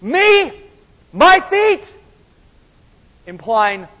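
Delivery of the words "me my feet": high, loud, disbelieving; each word flung out in shock; incredulous Peter. Retold or imitated. Preacher acts Peter's stunned refusal, loud and high. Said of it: "what Peter said was".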